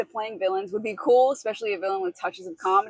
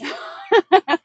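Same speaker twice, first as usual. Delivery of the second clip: evil giggle; 10 semitones higher, 9 dB louder than usual. Pretend. Laugh after villain line.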